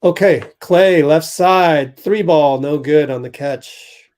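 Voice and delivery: loud, quick game call; play-by-play. Acted. Calls the game live.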